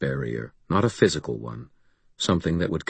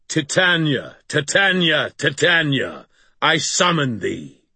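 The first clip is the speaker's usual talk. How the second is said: high, ringing cry of command; thundering summons; 8 semitones higher than usual. A narrator's reading. Wizard summoning Titania with joined voice and will, thundering. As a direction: thunder the name out, pitched far up. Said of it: "lifted my joined voice and will and thundered".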